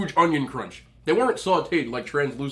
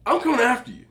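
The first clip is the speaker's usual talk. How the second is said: mock threat; 9 dB louder than usual. Real, not acted. Mock threat, then laugh.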